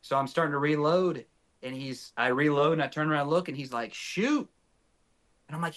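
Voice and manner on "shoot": retold prod, shooting up very high; urgent urging. Partner sees a bull and wants a shot, urgent urging. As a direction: imitate an insistent partner, pitch leaping up.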